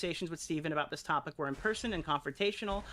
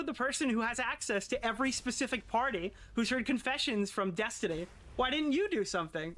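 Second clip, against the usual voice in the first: ordinary talk, nothing marked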